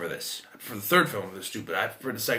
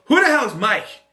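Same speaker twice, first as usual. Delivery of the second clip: high, loud annoyed call; annoyed sister; 11 semitones higher, 8 dB louder than usual. Retold or imitated. Sister overhearing the basement game, annoyed shout. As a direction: yell it as an irritated sister. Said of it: "hearing my sister go".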